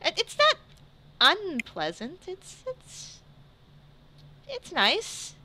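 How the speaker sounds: high-pitched, halting, unsure delivery; hesitant, dazed Tegan. Acted. Hallucinating Tegan answers the friend comforting him, high and hesitant. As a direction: feel out each word in a high, wondering voice.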